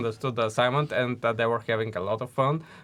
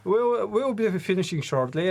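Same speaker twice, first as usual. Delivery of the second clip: sheepish; 8 semitones higher than usual. Retold or imitated. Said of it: "And we were like".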